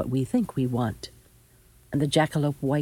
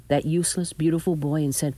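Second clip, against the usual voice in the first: usual speaking voice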